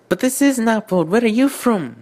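high, loud Lee Mack impression. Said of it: "sort of a Lee Mack voice".

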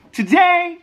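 high-pitched playful today; high silly voice. Acted. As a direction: chirp it up high. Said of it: "where I do voices".

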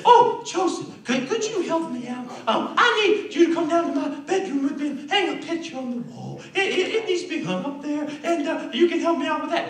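high falsetto woman's voice, coaxing; coaxing temptress. Acted. Potiphar's wife luring Joseph to her bedroom, coaxing falsetto. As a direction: play a hammed-up seductress in falsetto.

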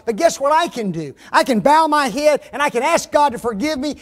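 loud, emphatic preaching. Real, not acted. Preacher confessing sin to the congregation, forceful preaching.

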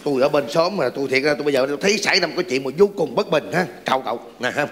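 neighbor character. Acted. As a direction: drop into a deep comic neighbor. New neighbor character enters.

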